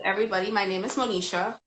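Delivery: usual speaking voice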